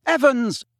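loud, high call; shouting after someone. A narrator's reading. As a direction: call her back as she leaves. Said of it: "James shouted after her".

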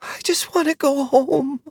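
put-on a child about to cry, voice unsteady; wobbly near-tears. A narrator's reading. Orphan boy pleading with the rider, voice wobbling near tears. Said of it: "The wobble in his voice came naturally".

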